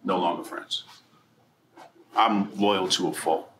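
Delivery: normal talking voice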